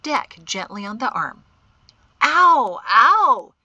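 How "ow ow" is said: loud, high mock-pained yelp; melodramatic cry. A narrator's reading. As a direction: ham up the pain loudly. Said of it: "he cried melodramatically".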